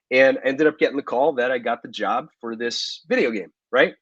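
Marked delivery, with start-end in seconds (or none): none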